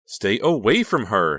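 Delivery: loud, slow excited cry; wild cry. A narrator's reading. Honey keeping Bill from Becca, wild cry. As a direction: cry it out wildly. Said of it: "she cried".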